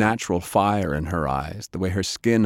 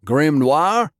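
raised, high questioning shout; 7 semitones higher, 6 dB louder than usual. A narrator's reading. Man shouting to identify the gunmen, loud questioning shout. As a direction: call out to check who's there. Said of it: "he shouted".

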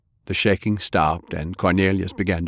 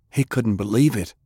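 plain reading voice

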